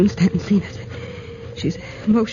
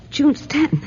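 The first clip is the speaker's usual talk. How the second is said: ordinary talk, nothing marked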